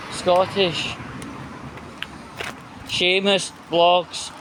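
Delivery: ordinary delivery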